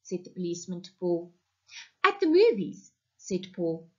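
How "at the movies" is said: put-on child voice. A narrator's reading. Child Paul answers policeman.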